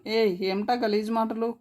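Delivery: plain reading voice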